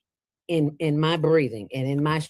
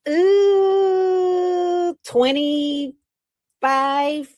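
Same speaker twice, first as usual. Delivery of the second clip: drawn-out thinking; 13 semitones higher, 6 dB louder, much slower than usual. Real, not acted.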